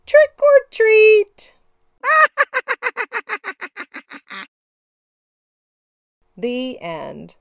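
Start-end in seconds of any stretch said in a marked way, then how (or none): 0.0-1.5 high, loud, drawn-out witchy shout; loud slow call of trick or treat. Acted. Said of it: "Giggling together and shouting". 2.0-4.4 witch giggle. Acted. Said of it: "Giggling together".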